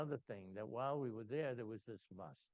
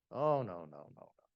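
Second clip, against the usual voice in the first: mock firm refusal, starting loud; acted customs refusal; 11 dB louder than usual. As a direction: imitate an agent's loud "oh no", then drop away. Customs agent refuses at airport.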